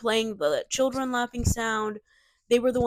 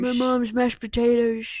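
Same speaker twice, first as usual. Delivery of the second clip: imitating Mike's odd line; weird way of saying it; 4 dB louder than usual. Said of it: "said it with such a weird".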